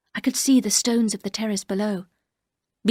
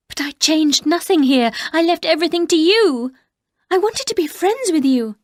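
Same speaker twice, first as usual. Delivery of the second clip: higher, louder earnest protest; pleading protest; 8 semitones higher, 5 dB louder than usual. Acted. Narrator defending herself to the housekeeper, raised pleading. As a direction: insist you meant well, voice rising.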